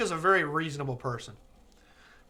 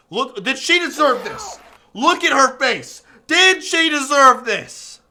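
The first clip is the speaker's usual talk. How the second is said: loud, high outcry, last line slow; anguished plea; 8 semitones higher, 12 dB louder, slower than usual. Real, not acted. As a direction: cry out in guilt. Pleading over deleted character.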